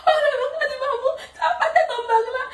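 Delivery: high laughing fake sobs; a playful wail to mama; mock crying. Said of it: "on peut pleurer comme ça". (faux sanglots aigus en riant)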